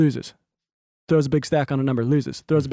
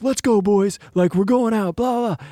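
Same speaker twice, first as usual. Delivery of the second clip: higher-pitched, hyped-up party guy; mock pumped-up winner's chant; 6 semitones higher than usual. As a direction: imitate a buddy rallying the boys after a win. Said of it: "he's all pumped".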